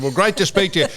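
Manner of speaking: high, loud radio-host greeting; breezy host hello; Tony Charlton voice. Retold or imitated. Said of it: "He said".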